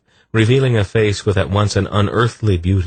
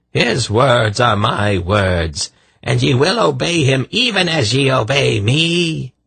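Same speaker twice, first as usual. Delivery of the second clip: high, imperious madman; shrill proclamation; 8 semitones higher, 3 dB louder than usual. A narrator's reading. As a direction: lay down the law in a high voice. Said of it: "Irvan announced".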